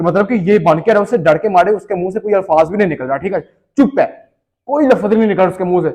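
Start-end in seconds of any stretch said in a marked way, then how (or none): none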